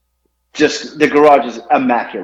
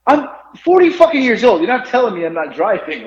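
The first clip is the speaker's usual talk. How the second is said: exasperated retort; 8 semitones higher than usual. Retold or imitated. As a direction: pitch jumps up high on forty, indignant. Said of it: "I was like".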